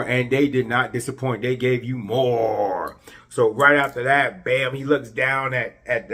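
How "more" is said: MORE stretched out long and slow; drawn-out emphasis. Real, not acted. Fan raving that the show did not disappoint, MORE drawn out. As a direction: draw out MORE for hype. Hypes how much the show delivered.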